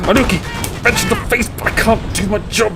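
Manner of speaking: lightly voiced nagging colleague; imitating a nagging manager; whining community manager. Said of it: "getting told by my community manager".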